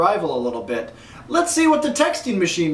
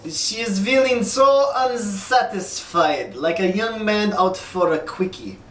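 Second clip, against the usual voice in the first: a bad Russian accent, like the card-table villain in Rounders; John Malkovich Russian accent. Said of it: "said in a Russian voice from Rounders".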